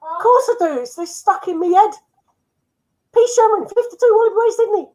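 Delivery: high-pitched cartoon fish impression; Dory. Player proves he knows the Nemo address, in a high character impression. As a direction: put on a high, squeaky animated-fish voice. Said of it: "that was my Dory impression".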